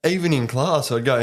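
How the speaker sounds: ordinary talk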